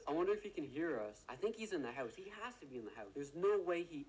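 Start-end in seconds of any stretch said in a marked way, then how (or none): none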